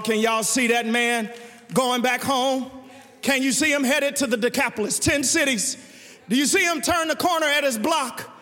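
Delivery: usual speaking voice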